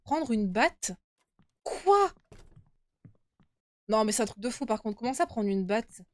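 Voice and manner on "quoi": high loud startled "Quoi"; shocked disbelief; surprised. Real, not acted. Shocked at taking a bat.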